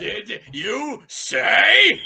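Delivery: slow, loud, laughing question; drawn-out question. Acted. Twilight asking the pair, slow and loud. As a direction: stretch it out in disbelief. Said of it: "Twilight asked".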